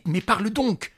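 impatient, loud and high; angry command. Acted. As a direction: snap at him to speak. Master pressing the servant.

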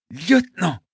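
forcing the word out through pain; a wounded man articulating with effort; strained effort. Acted. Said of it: "articula avec effort".

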